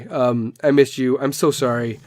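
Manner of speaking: ordinary delivery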